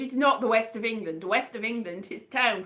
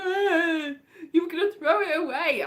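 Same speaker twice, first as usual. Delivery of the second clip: high-pitched mock crying; hammed-up tearful protest, pitched high; imitated sad wail; 5 semitones higher than usual. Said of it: "it was very sad".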